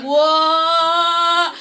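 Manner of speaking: loud, high drawn-out whoa; alarmed whoa. Retold or imitated. Son watching dad overdo the vape, alarmed loud whoa. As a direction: yelp as the hit goes way too far. Alarm at dad's huge hit.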